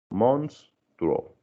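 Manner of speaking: "monstruo" broken in two, mons-truo, with a clear gap; split by syllables. Shown as a demonstration. Teacher showing how four consonants divide, "monstruo" split by syllables. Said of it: "dividir por sílabas".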